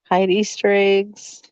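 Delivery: ordinary delivery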